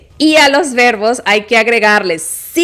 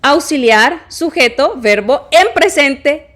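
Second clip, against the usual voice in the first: usual speaking voice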